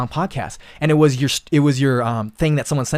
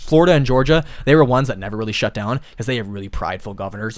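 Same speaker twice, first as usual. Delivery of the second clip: ordinary talk, nothing marked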